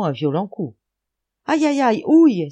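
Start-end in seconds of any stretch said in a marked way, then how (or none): 1.5-2.5 high, loud yelps of pain; pained cry. A narrator's reading. Schultz hit in the face by the rake handle, pained cry. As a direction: yelp loudly as if just struck. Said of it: "s'écria monsieur Schultz".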